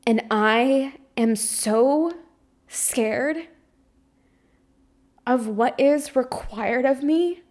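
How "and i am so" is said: normal talking voice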